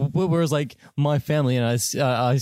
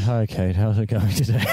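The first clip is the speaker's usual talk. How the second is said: low, lifeless greeting; deadpan, as if possessed; deadpan put-on voice; 3 semitones lower than usual. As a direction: greet someone in a dead-faced drone. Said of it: "talking to my sister like this".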